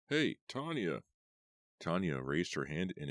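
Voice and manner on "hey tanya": high called-out hey; yelling a greeting. A narrator's reading. Keith hails Tanya from below, high yell. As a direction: call up to her across the stands. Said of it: "Keith yelled".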